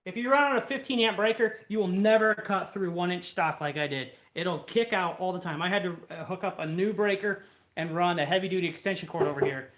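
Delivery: ordinary talk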